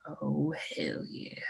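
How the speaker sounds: dog's whispered voice. Retold or imitated. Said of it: "he's like".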